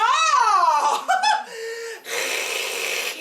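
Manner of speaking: long high peal of laughter; high ecstatic laugh. Acted. Cook overcome with pleasure, high long laugh.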